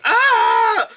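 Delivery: high mock scream; exasperated scream. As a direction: cringe shriek. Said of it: "I was like".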